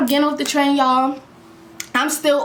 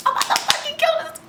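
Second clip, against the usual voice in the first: very high mock fury; furious. As the train doors close, very high mock fury. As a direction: snap with rage.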